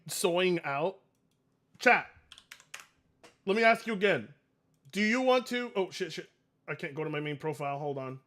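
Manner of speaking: ordinary talk, nothing marked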